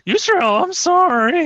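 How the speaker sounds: whimpering kobold apology. Acted. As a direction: squeak out a sorry on the edge of tears. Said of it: "starts crying a little bit".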